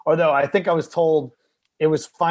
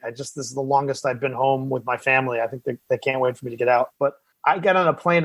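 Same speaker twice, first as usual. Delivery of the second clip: ordinary talk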